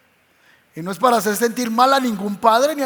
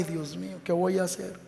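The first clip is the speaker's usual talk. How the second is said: despairing murmur; 4 semitones lower, 10 dB quieter than usual. Retold or imitated. Worried believer at night.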